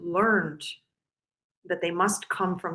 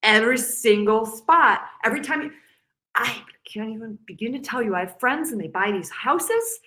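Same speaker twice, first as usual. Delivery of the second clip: normal talking voice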